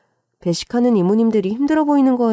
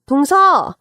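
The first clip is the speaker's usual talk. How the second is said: raised, higher-pitched call; loud call; 5 semitones higher, 4 dB louder, much slower than usual. A narrator's reading. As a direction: shout to get someone's attention. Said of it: "큰 소리로 동서를 불렀습니다".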